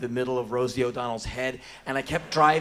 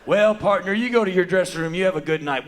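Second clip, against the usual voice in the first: louder, higher hearty lawman voice; friendly Texas cop; 5 semitones higher, 9 dB louder, faster than usual. Acted. Policeman sends singer to dressing room, hearty. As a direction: send him off like a folksy Texas officer.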